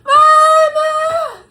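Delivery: high, loud wail for mama; long, high mock cry; imitated hollering. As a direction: holler it like a child being dragged away. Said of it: "And they hollering".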